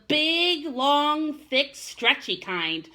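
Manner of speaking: slow, savoring drawl; relishing slowly. Acted. Mother teasing Chester about worms, slow and savoring. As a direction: stretch the words like a worm.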